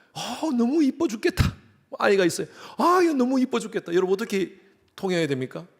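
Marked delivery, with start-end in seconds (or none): 0.1-1.6 high, drawn-out gush over cuteness; gushing adoration. Acted. Preacher acting a Korean fawning over a cute child, gushing. 2.8-3.9 put-on high, loud gush over a cute kid; gushing adoration. Preacher acting someone doting on a child, gushing.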